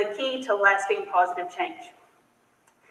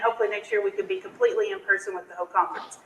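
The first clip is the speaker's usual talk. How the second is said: normal talking voice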